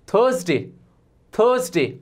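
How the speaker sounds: "थर्सडे" as thuhsday with no r; teacher modelling "थर्सडे" without the r; no r sound. Shown as a demonstration. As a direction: drop the r in "थर्सडे". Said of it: "आर को मैंने pronounce नहीं किया".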